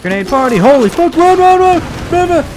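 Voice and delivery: rising into a high, loud scream; excited yelling. Real, not acted. Player running from grenades, excited yelling. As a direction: yell it, building to frantic shrieks.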